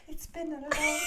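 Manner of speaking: high-pitched mimicking of a lyric; amused imitation. Host quoting him during her emotional story, amused. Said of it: "and he's like".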